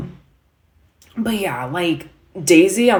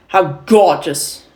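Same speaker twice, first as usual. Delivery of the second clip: loud, slow New York delivery; sarcastic New York stage line; New York accent; 5 dB louder, slower than usual. Acted. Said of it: "speaking with a New York accent".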